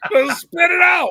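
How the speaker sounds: loud, high demand; goading shout. Real, not acted. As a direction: holler at them to come out with it. Goading friend to say it.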